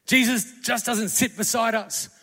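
loud, rising pulpit cry; full-force preaching. Acted. Preacher rallying the congregation with Jesus as a war horse, loud and fervent. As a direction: build it like a battle charge.

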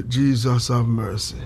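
slow, low plea for mercy; flustered plea. Real, not acted. Flustered at his own slip, a slow low plea. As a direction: draw it out low, in real dismay.

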